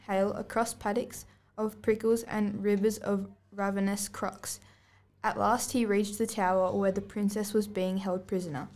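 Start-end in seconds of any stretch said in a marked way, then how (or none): 4.1-4.6 hushed spooky word; whispered. Acted. Narrator hushes the scary crocs, whispered. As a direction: breathe it out.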